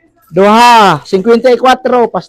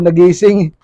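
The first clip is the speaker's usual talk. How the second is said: usual speaking voice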